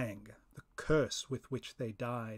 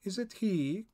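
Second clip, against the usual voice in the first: higher, questioning spirit voice; a high eerie voice calling through the air; first spirit voice; 7 semitones higher, 4 dB louder than usual. Acted. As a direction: ask it as an eager unseen spirit. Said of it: "two voices in the air".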